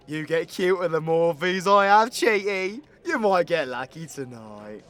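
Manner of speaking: teasing banter. Acted. Tipsy teasing after a shot.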